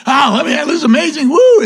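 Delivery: high excited squeal up to a woo; mock giddy cheer ending in a high woo; excited whoop. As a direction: burst out like a fired-up new believer. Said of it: "You're like".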